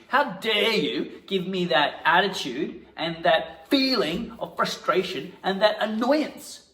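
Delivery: high, loud mock outrage; punchy indignation. Acting teacher punching lines at partner in mock anger. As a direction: hit each accusation hard. Improvised quarrel, punch exercise.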